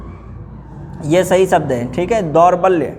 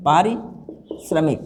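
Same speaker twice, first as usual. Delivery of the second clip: पारिश्रमिक said slowly and softly, syllable by syllable; slow dictation; 3 semitones lower, 5 dB quieter, much slower than usual. Shown as a demonstration. Teacher dictating the correct form, slow and soft. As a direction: ease out पारिश्रमिक for them to write. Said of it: "ये सही वाला हम लिखा देना".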